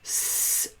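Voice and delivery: "s" as a long, soft unvoiced hiss; hissed s sound. Shown as a demonstration. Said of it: "Alors, te et à ta".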